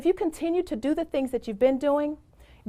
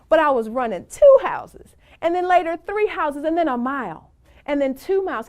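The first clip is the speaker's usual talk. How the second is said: excited; 4 semitones higher, 7 dB louder than usual. Real, not acted. Building up a running triumph.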